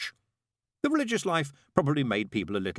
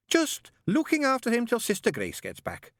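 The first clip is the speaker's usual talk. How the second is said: high, bright-voiced chattering nun; fussy nun; 4 dB louder than usual. A narrator's reading. Nun greeting the father in a high voice. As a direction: pitch it high like a flustered nun. Narrator voices Sister Mary.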